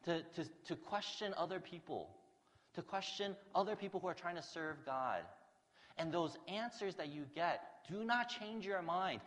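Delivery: loud, high, driving preacher's voice; forceful preaching. Acted. Preacher challenging the congregation, loud and forceful. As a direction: press the congregation hard from the pulpit. Preacher pressing a point hard.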